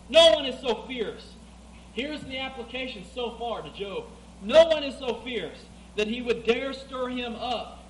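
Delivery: loud, high voice of God from Job; booming scripture reading. Acted. Preacher reading God's challenge to his flock, booming. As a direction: thunder out God's questions.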